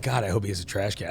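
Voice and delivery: low, soft aside to oneself; retold muttered worry. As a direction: quietly voice a worried thought. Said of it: "At first I was like".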